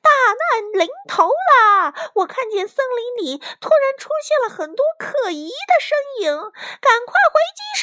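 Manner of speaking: very high, wailing alarm; panicked shout. A narrator's reading. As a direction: shriek a warning to run home. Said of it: "卡莉姨妈惊慌地大喊". (惊慌失措地尖声大喊)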